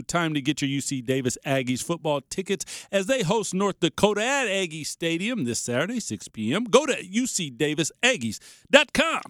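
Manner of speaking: upbeat radio promo read; lively on-air ad copy. Acted. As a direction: sell the tickets like a station spot.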